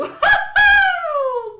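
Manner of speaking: high, loud delighted shriek of laughter; loud squealing cackle. Real, not acted. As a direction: burst into a piercing laugh. Laughing at the card reading.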